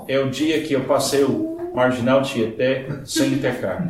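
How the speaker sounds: normal talking voice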